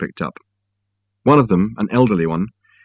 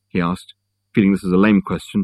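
ordinary delivery

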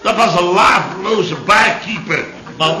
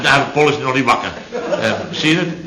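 normal talking voice

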